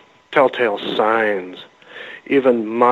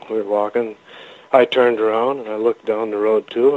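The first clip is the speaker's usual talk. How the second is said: usual speaking voice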